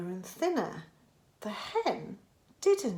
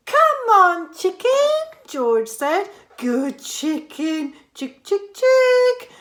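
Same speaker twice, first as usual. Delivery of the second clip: high sing-song chick-chick call; high boy's voice coaxing a hen; 9 semitones higher, 8 dB louder, slower than usual. A narrator's reading. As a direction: tempt the hen to the spoon. George coaxes the hen.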